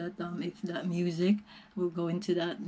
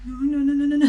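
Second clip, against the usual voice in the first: high tearful no no no; fighting back real tears; crying; 7 semitones higher, 7 dB louder, much faster than usual. Real, not acted. Vlogger tries not to cry at the climax, tearful protest. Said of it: "I did cry".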